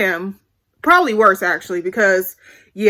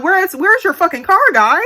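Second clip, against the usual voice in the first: raised, pitch jumps up; exasperated demand; 6 semitones higher, 4 dB louder than usual. Retold or imitated. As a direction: demand it, fed up. Confronting boyfriend about car.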